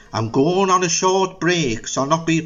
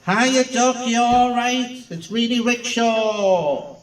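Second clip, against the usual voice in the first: high, camp showman greeting; breezy camp hello; camp host voice; 6 semitones higher than usual. Acted.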